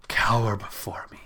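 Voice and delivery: breathy threat; menacing whisper. Acted. Storm queen menaces the mortal in a low whisper. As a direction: hiss the order softly.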